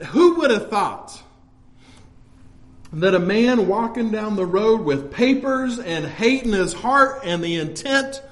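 usual speaking voice

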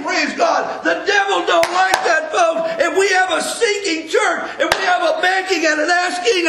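high ringing preacher's cry; fervent preaching. Acted. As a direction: call it out to the whole church. Preaching at full force.

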